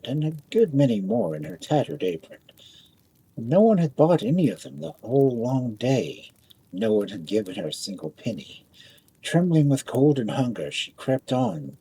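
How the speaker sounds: usual speaking voice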